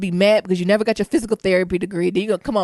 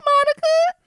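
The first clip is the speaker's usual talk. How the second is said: pitched way up, imitating classmates; cheery high hey; high mock greeting. Voicing classmates greeting her.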